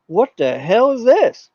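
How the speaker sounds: slow, high, incredulous; stunned disbelief. Retold or imitated. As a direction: react like you can't believe it. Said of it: "and I'm like".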